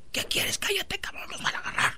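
tense, rapid hushing; urgent whisper. Acted. Lover hiding in closet with boy, urgent whisper. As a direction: whisper so nobody catches you.

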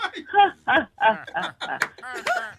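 drawn-out mock "ha ha ha"; imitated fake laugh. Caller showing the host how he laughs on camera, fake laugh. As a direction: fake a long, forced laugh. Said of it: "Entonces cuando te ríes".

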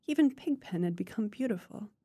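usual speaking voice

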